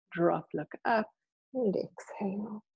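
soft breathy whisper. Acted. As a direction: whisper the cue like breath itself. Whispered breathing cue in class.

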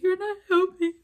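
high, loud retold outburst; exasperated yell. Teen snapping at the yelling lady, full-voiced yell. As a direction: yell it out in exasperation, pitch jumping up.

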